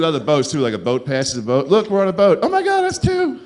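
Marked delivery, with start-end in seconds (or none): 1.7-2.4 louder, higher gloating boater; mocking boater. Comic mimicking boaters bragging to each other, higher. 2.4-3.4 very high excited squeal; mock squeal. Comic imitating a boater spotting another boat, squealing.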